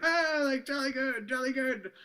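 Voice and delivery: very high, loud mock posh British cheer; British accent. His friends cheering in a high mock British accent. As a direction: cheer like a plummy English crowd. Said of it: "his friends are like".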